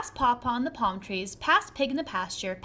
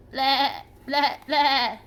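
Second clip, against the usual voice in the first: louder bleating bleh; goat bleat; 2 semitones higher, 5 dB louder, much slower than usual. A narrator's reading. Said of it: "The goat bleated".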